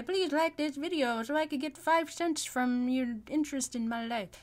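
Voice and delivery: high, loud hammed-up begging; mock YouTuber plea. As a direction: ham up a greedy influencer plea. Mocking a money-grubbing YouTuber plea.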